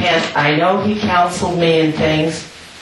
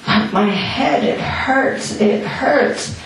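much higher, strained cry of pain; 7 semitones higher, slower than usual. Retold or imitated. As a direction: let the pain pitch the voice up high. Headache getting worse.

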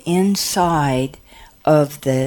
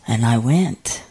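usual speaking voice

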